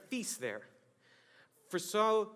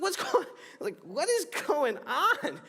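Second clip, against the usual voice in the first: much higher, drawn-out baffled question; confused disbelief; 8 semitones higher, 3 dB louder than usual. Retold or imitated. Guests baffled by Samson's riddle, drawn-out confused cry. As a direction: act out being totally lost.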